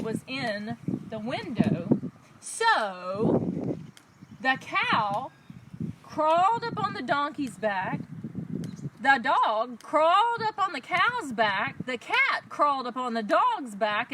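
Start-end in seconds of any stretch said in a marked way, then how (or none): none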